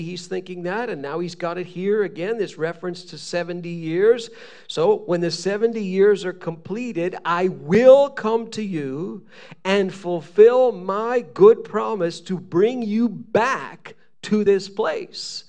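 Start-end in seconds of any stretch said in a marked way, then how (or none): 4.7-15.4 loud, slow, raised proclamation; emphatic scripture reading. Acted. Preacher reads God's promise to exiles, proclaiming loudly. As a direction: declaim it as God's solemn promise.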